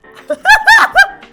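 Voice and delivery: high shrieking laugh; burst of laughter. Real, not acted. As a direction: cracks up helplessly despite trying.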